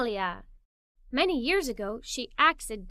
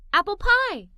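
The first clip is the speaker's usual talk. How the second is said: excited call; 6 semitones higher, 5 dB louder than usual. Acted. Family calling out ideas.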